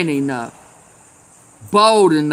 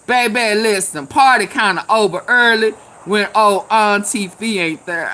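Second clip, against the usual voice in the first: normal talking voice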